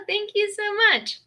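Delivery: excited high thank you; gushing thanks. Real, not acted. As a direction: pour out thanks to the donors. Thanking a donor.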